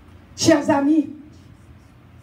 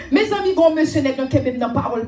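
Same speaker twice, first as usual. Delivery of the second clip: ordinary talk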